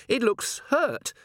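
high, worried voice; receding panic. A narrator's reading. Dwarf looking for Angua, lingering panic. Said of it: "her panic receding a little".

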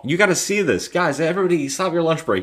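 high, loud mock summons; rally everyone to come and gawk; hammed-up excited holler to coworkers; excited calling. Cop rallying everyone to watch.

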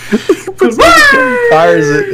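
cracking up mid-story; can barely get it out for laughing. Real, not acted. Laughing while telling story.

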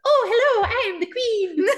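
shrill-free put-on granny queen voice, slow; terrible high-pitched old lady voice. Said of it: "terrible, high-pitched old lady voice".

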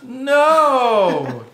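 loud high No!; exasperated shout. Real, not acted. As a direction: shout it out in disbelief. Exasperated at wrong guess.

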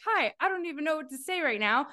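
high, hurried awkward greeting; friend's first video, lightly mocked; mimicking awkward intro. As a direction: play her first nervous video.

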